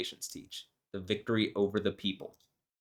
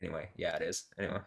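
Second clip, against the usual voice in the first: ordinary talk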